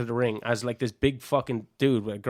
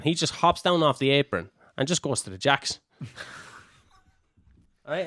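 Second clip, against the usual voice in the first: usual speaking voice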